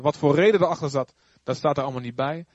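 usual speaking voice

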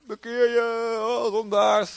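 high, mock doom-preacher shout; street doom preacher. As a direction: ham up a bell-ringing street prophet. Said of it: "met een grote bel". (spottende straatprediker)

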